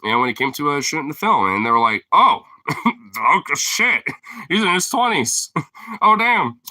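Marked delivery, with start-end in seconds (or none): 2.1-6.6 high, laughing shock; oh no, he's older; laughing surprise. Retold or imitated. Kids realizing he's older.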